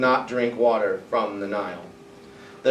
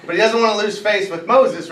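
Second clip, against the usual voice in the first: normal talking voice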